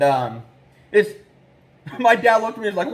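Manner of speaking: ordinary talk, nothing marked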